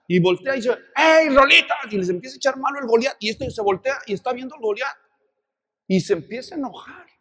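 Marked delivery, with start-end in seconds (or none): 1.0-1.7 higher, loud mocking call; taunting shout. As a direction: jeer at the enemy line. Goliath taunting the army.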